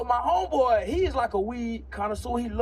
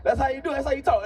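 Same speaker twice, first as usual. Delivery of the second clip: normal talking voice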